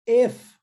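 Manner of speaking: "if" said as "ef", short e vowel; "if" soa como "ef"; if with e sound. Shown as a demonstration. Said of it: "eu não estou falando if".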